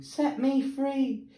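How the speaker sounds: high, slow plea; begging. A narrator's reading. Mermaid begging Sam Sly for freedom, high and slow. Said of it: "when she begged him".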